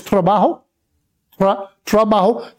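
trabajo with one light tap on the R; trabajo, Spanish, R flipped once after the T; just tap the R in trabajo; single R flip. Shown as a demonstration. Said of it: "you got one little flip".

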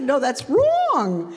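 high, long drawn-out "wrong"; acted insistent rebuttal, slow and high; emphatic contradiction. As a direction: contradict him flatly, stretching the last word. Retold argument with excited brother.

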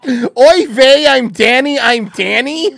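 high, loud hammed-up oy vey; mocking Danny impression.

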